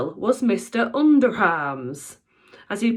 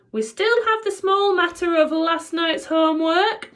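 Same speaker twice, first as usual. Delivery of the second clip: gloating witch teacher, higher and louder; evil relish; 10 semitones higher, 4 dB louder than usual. A narrator's reading. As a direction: savor every word like a cruel teacher. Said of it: "announced with an evil relish".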